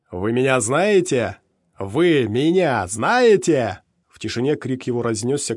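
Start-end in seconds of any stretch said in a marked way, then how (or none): 0.1-3.8 slow, drawn-out bellow, much louder; roaring shout. A narrator's reading. Mercenary roaring at the crowd of stalkers: slow furious shout. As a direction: roar it at the crowd, slowly. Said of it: "потряс ими над головой и проорал".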